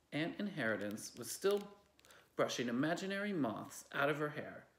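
usual speaking voice

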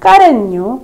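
usual speaking voice

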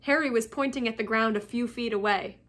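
ordinary delivery

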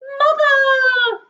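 high, drawn-out shout; long high call. A narrator's reading. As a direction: cry out for mother from the crowd. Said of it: "a voice called from the crowd".

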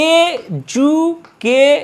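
এজুকেশন very slowly, in four beats; syllable by syllable. Shown as a demonstration. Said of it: "চারবার stroke করছে".

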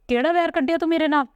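high, fast and hurt, accusing his brother; angry accusation. Acted. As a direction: fling the accusation at him, voice shooting up. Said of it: "ਦਰਸ਼ਨ ਵੀ ਗੁੱਸੇ 'ਚ ਆ ਗਿਆ". (ਉੱਚੀ ਤੇ ਤੇਜ਼ ਗੁੱਸੇ ਭਰੀ ਆਵਾਜ਼)

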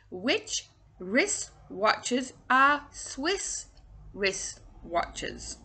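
very slow, drawn out; slow tongue twister. Shown as a demonstration. Coach reading the twister slowly before the fast try. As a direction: take it word by word. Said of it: "Try saying that fast".

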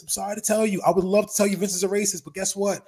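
ordinary talk, nothing marked

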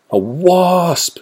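high-pitched cry; cry of alarm. A narrator's reading. Wombat spots a wasp, crying out. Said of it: "she cried".

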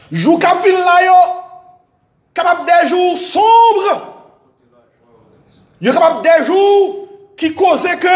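high, ringing, impassioned sermon; preaching at full force. Acted. Pastor urging brothers and sisters, full-force preaching. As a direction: preach it out over the church. Preaching climax on dark days.

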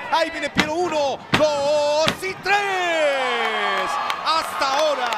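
slow, booming ringside proclamation; dramatic announcer. Acted. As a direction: announce doom to the crowd.

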